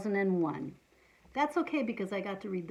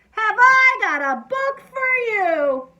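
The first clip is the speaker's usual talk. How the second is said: full-volume high, drawn-out holler; excited yell; 11 semitones higher, 15 dB louder, slower than usual. A narrator's reading. Teacher yelling to the boy that she has a book, excited yell. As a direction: belt it out like a big reveal. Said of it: "Then she yelled".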